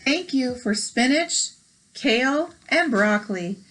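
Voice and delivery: bright storybook read-aloud; gentle picture-book narration; reading aloud to children. Acted. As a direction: read it like story time for kids.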